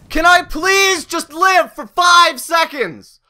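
ordinary talk, nothing marked

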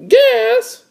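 very high cartoon child voice; little sister. Little sister spying words to match her brother, very high voice. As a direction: pitch it way up as a kid sister.

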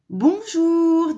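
high, loud, drawn-out hello; wolf greeting. A narrator's reading. Wolf greeting mother hen, loud high wolf voice. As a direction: draw out a big loud greeting. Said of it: "dit le loup".

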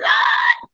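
a sudden high mock yelp of surprise; startled yelp. Teacher hams up a startled yelp at seeing a student. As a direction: yelp like she just got startled. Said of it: "I said".

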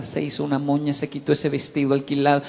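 ordinary delivery

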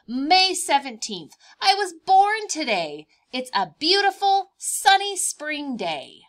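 lightly voiced cheerful baby insect; mantis diary voice. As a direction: read as the excited baby mantis.

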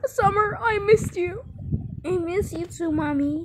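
high-pitched play-acted greeting; emotional reunion voice. Parent reunited with Summer, high acted emotion.

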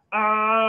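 short loud "ah" shout; a loud echo of the classroom yell "ah"; mock shout "ah". Shown as a demonstration. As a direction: call out "ah" again, loud. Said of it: "el sonido del grito".

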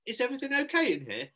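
high, polite enquiring voice; Hector's mum. Acted. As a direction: call in sweetly on a scene. Hector's mum interrupts the scene.

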